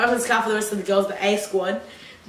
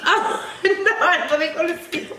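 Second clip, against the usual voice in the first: high, laughing through the words; laughing till nearly crying; cracking up; 8 semitones higher than usual. Real, not acted. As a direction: struggle to talk through giggles. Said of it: "I'm gonna cry".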